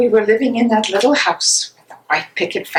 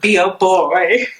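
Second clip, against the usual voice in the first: ordinary talk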